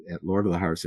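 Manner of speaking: usual speaking voice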